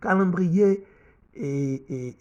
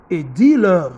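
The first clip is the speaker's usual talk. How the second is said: a much louder et dis-leur; forceful command; 9 dB louder, slower than usual. Acted. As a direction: thunder the command. Reading scripture, forceful command.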